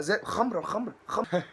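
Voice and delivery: ordinary talk, nothing marked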